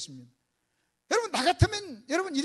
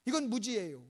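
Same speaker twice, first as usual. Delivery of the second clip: usual speaking voice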